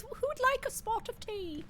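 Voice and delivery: high-pitched mock British accent; hammed-up British accent, climbing high. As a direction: offer it like a dainty British tea host. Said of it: "That's my British accent".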